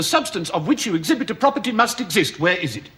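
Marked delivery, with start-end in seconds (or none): none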